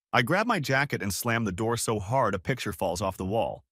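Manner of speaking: ordinary delivery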